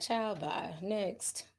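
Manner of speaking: retelling her own unimpressed reaction, muttered; dismissive murmur. Retold or imitated. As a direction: brush it off under your breath. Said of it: "I was like".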